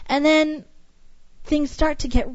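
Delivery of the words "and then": ordinary talk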